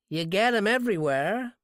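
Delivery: friendly small-town nosiness; drugstore clerk. Acted. Drugstore clerk chats up the stranger buying cigarettes.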